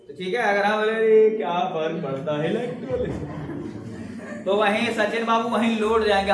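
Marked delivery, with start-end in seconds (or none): 0.9-4.4 slow, listless drawl with no energy; mock can't-be-bothered voice; lazy, slack teacher. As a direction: act like you couldn't care less about teaching. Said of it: "अगर हम ढीले पड़ गए".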